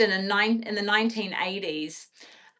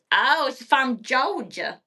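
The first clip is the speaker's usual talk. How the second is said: normal talking voice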